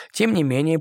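plain reading voice

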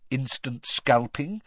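ordinary delivery

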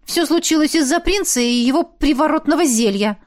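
through gritted teeth. A narrator's reading. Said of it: "зло процедила".

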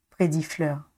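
plain reading voice

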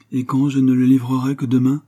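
a mildly testy challenge, pushed in volume; curt reply. A narrator's reading. Painter to his wife about the portrait's delivery, curt. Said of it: "répondit Flochardet sèchement".

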